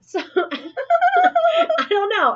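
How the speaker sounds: high, slow words through real laughter; laughing. Real, not acted. Teller laughs at her own joke with co-host, giggling.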